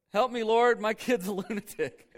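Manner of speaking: high, loud cry breaking into a laugh; mock desperate plea. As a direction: plead loudly with God, half laughing. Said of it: "have you ever felt like saying that to the Lord".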